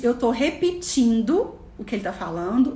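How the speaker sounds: ordinary delivery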